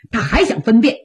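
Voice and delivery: ordinary delivery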